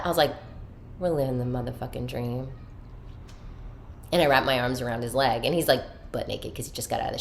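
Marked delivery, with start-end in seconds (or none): 1.0-2.5 hushed punchline. Retold or imitated. Acted punchline after his "What?.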